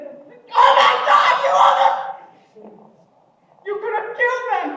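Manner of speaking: high, loud, slow panicked laughing cry; freaked out. Acted. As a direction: gasp it out in shock. Dad freaking out near miss.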